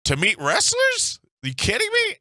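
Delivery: can't believe what he's hearing; outraged disbelief. Retold or imitated. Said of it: "I was like".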